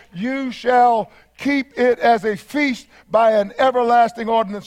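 ordinary delivery

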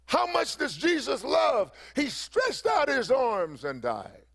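high, ringing preacher's shout that slows on the last line; old preacher shouting to the congregation; retold preacher's holler. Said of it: "He hollered out one day preaching".

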